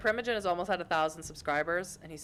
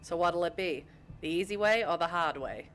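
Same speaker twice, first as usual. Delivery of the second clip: broad Boston accent with dropped R's; Boston-accented tough talk. Shown as a demonstration. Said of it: "I can do my Boston accent".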